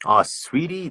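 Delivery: high mocking "Ah, sweetie"; a hammed-up syrupy "sweetie". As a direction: mimic a syrupy voice you'd never use. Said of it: "we don't use that".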